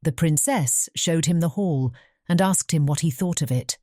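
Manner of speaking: plain reading voice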